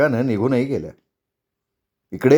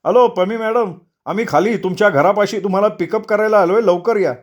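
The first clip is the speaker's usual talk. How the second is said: higher, polite phone-caller voice; safari caller; 6 semitones higher than usual. Acted. Safari driver phoning the wife for pickup, businesslike. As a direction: call the madam down to her pickup.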